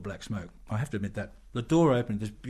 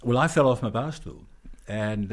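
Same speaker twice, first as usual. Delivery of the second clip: normal talking voice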